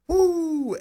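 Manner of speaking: a high, happy whoop for a friend; excited woo. Real, not acted. Host whooping at Aaron's shout-out, excited. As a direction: cheer her name in with a whoop.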